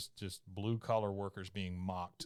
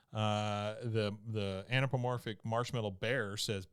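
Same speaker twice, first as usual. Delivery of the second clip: ordinary talk, nothing marked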